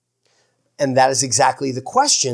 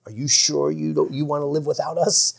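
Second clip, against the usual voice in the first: softly imitated tempting whisper, still voiced; mock whisper; 5 dB quieter than usual. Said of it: "they were whispering to me like".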